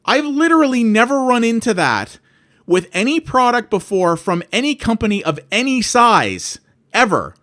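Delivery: high and loud incredulous rant; you cannot believe what you're hearing; outraged disbelief. Retold or imitated. Customer telling the warranty rep this is unheard of, incredulous. Retold angry phone call, customer support.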